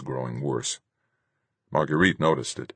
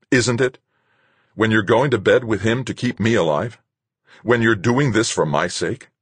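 raised, louder, accusing questions; heated accusation; 7 semitones higher, 5 dB louder than usual. Acted. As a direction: confront her angrily. Acted quarrel, accusing questions.

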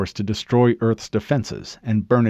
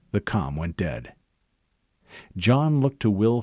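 plain reading voice

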